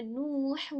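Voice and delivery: نوح with its "oo" held long; drawn-out vowel. Shown as a demonstration. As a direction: stretch the first vowel of نوح to demonstrate. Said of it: "ہم کھینچ رہے ہیں".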